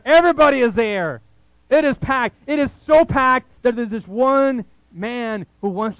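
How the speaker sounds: loud, rising preacher's build; preaching at full force. Acted. Pastor to congregation, booming preaching voice. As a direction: pour it out, everybody is there. Preaching the packed house scene.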